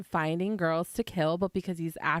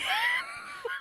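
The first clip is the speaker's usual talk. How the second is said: high squealing laugh; shrieking laugh; 4 dB quieter than usual. Real, not acted. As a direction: scream with laughter. Laughing at brother's insult.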